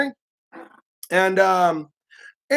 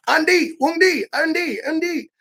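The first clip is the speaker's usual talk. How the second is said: relentless host urging; 9 semitones higher than usual. Retold or imitated. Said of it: "they're relentless".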